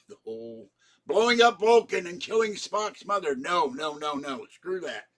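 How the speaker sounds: rising, loud fan outrage; indignant outburst. Real, not acted. Fan ranting about Vulcan being destroyed, loud and indignant. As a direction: burst out against the plot twist.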